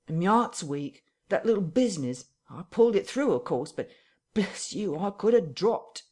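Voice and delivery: frightened tramp pleading with his captor; near tears. Acted. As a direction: beg like he might cry any second. Marvel whines about his heart.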